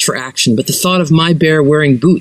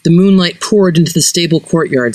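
ordinary delivery, nothing marked

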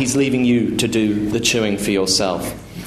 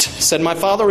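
plain reading voice

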